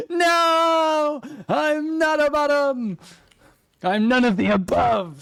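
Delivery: high, loud, hammed-up protest shout; mock outraged denial. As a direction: shout the denial like a scandalized diva.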